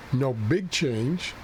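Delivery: usual speaking voice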